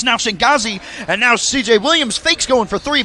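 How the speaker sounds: brisk live sports call; play-by-play commentary. Acted. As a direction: call the game as it happens.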